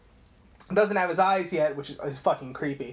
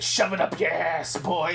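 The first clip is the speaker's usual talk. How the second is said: imitated boss. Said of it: "He's like".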